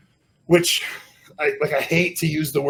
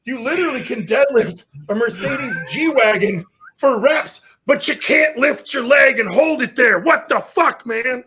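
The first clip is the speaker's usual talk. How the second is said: exasperated self-berating; 7 semitones higher, slower than usual. Retold or imitated. Deadlifter scolding himself over a leg lift, exasperated. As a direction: argue with yourself, pitch climbing in disbelief.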